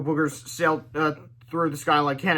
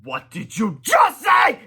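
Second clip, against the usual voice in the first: high, slow, loud outraged shout; rising toward a scream; 9 semitones higher, 8 dB louder, much slower than usual. Acted. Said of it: "she cried in a voice ... becoming a scream".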